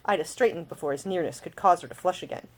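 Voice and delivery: plain reading voice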